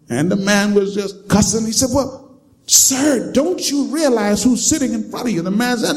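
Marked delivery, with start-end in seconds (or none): 1.9-5.4 high pleading voice. Retold or imitated. Attendant asks drunk man.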